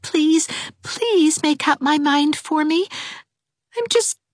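mildly tearful, pitch climbing high; a touch of tears rising in the voice. A narrator's reading. Dovey begging Anne to decide for her, mildly tearful. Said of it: "Dovey's voice broke on a tearful note".

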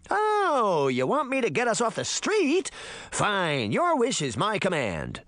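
high, drawn-out mocking echo easing down; sarcastic yell. A narrator's reading. Puck mocking Sabrina's demand, yelling sarcastically. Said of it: "Puck yelled".

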